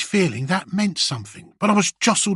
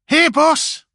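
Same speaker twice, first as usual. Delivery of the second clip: much higher, louder yell; shouted; 11 semitones higher, 5 dB louder than usual. Acted. The grot answering the boss, shouting. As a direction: shout it as loud as you can. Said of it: "I shouted, loud as I could".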